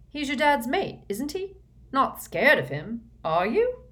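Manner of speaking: high, loud taunting jeer. Acted. Harry taunts Malfoy about Voldemort, high and jeering. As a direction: needle him with a high mocking jeer.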